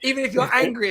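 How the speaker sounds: loud, high laughing tease; laughing while ribbing a friend. Real, not acted. Laughing, teasing Dan loudly.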